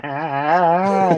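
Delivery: high short pained yelp; mock yelp of pain. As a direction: yelp as if your hand is being crushed. Del yelps as hand squeezed.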